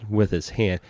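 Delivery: normal talking voice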